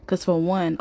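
ordinary talk, nothing marked

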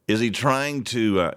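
ordinary talk

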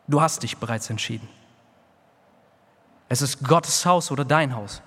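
normal talking voice

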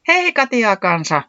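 high, slow hailing shout; excited call. A narrator's reading. Puuhis hails Katiaa kansa, calling out excitedly. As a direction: call out loudly to a friend. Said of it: "Puuhis huudahti".

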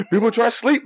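high-pitched mock complaint from the window; retold yell. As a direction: imitate hollering at a noisy neighbor. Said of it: "I can yell at you".

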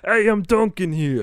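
loud, high mock Boston holler; Boston accent. Friend parodies the parking line, loud Boston accent. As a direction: shout it as a cartoonish Bostonian. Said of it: "can you do a Boston accent".

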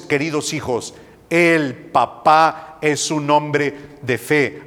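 ordinary talk, nothing marked

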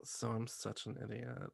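very soft, low mock admission; sheepish confession. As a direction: own up quietly, imitating an embarrassed contestant. Impersonates Amanda's sheepish confession.

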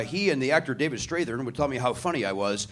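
ordinary talk

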